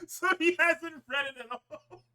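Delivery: high, slow words squeezed out through laughter; can barely get it out for laughing; cracking up mid-sentence. Real, not acted. Cracking up at the letter.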